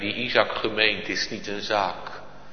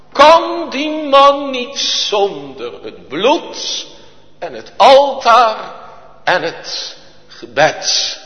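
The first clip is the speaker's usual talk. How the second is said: high, loud, slow preacher's cry; preaching at full force; 14 dB louder, slower than usual. Acted. Preacher crying out to the congregation, high, loud and slow. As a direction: stretch every word like a revival preacher.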